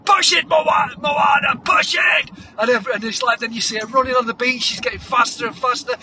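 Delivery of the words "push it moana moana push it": loud, high trainer's yell; hammed-up montage coach hollering; shouted urging. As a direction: drive the athlete on at full shout. Trainer urging Moana to push.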